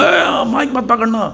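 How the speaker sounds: high, slow scolding snap; sharp mock yell. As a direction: bark it at him like a warning. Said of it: "तो दूसरा बोला".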